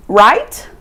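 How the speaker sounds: "right" rising as a question; questioning tag. Shown as a demonstration. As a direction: end on "right" with the pitch going up. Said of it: "Sempre termina com interrogação".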